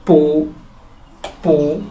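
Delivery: "পো" as a held syllable in rising second tone. Shown as a demonstration. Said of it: "উচ্চারণটা হচ্ছে নিচ থেকে উপর দিকে". ("পো" নিচ থেকে উপরে সুর তুলে)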